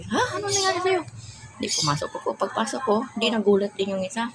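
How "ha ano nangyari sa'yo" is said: high, startled retelling of the neighbor; shocked question. Retold or imitated. Neighbor seeing her dirty and hurt, startled high cry. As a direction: gasp it out in alarm, pitched way up.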